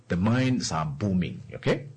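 ordinary talk

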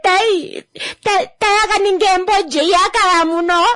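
high, loud sobbing plea; tearful wail in the auntie's voice; crying lament. Retold or imitated. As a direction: weep the words through tears.

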